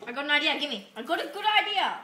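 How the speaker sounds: excited. Real, not acted. Excited about a new idea.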